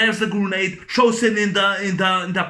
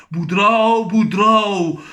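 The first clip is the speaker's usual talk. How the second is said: mock calling out; 4 dB louder, slower than usual. Villain calling out, acted film quote.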